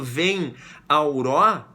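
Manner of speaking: slow syllable-by-syllable scansion of "vem auró"; scanning syllables. Shown as a demonstration. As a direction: count "vem auró" out slowly, merging the vowels. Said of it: "a gente junta as vogais".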